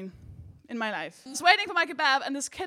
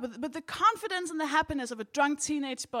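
normal talking voice

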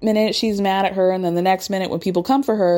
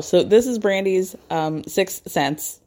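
ordinary talk, nothing marked

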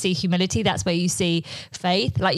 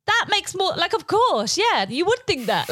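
much higher, dawning understanding; mock realisation; 10 semitones higher than usual. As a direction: play the aha moment lightly. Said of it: "And you go".

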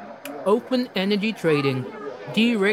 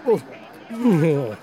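normal talking voice

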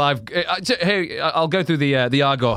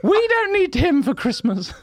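high wife voice; 12 semitones higher than usual. Acted.

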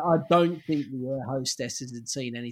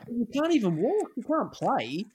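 higher, lightly voiced wife objecting; lightly voiced wife telling husband he can't play; mock wife scolding; 9 semitones higher than usual. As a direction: play the wife pointing out the obvious. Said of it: "she goes".

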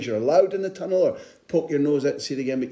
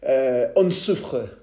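French phrase; 4 dB louder, slower than usual. Retold or imitated. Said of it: "I went for French".

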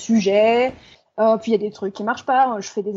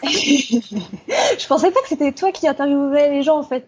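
amused, slow and higher; laughing; 5 semitones higher, slower than usual. Real, not acted. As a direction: laugh through the surprise. Surprised it's him interviewing.

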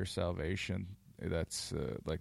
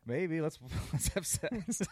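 higher-pitched, giggly woman's part; mock flirty character; 7 semitones higher than usual. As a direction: play her coy, cracking up. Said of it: "And she's like".

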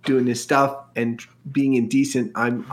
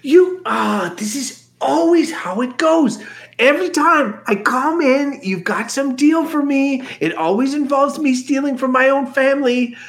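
put-on whiny high young voice; 9 semitones higher than usual. Flea complains.

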